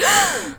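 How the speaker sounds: quick high gasp of shock; hammed-up crowd gasp; sharp high mock gasp. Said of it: "everyone's like".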